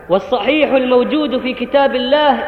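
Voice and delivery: ordinary talk, nothing marked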